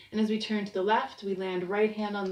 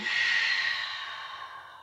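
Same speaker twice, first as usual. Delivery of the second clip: audible exhale. Shown as a demonstration. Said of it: "breathing in down".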